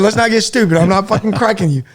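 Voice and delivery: shouting him down. Retold or imitated. Soldier refuses medic's plea to be cut open, loud and high. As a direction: shout it down, no way I'm cutting you.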